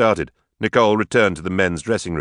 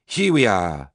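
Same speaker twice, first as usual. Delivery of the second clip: slow, drawn-out grand announcement; with a flourish; much slower than usual. A narrator's reading. Tipsy sister presents herself to the wedding party, with a flourish. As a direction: stretch it out theatrically. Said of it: "she said with a flourish".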